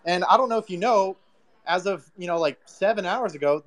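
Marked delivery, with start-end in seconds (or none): none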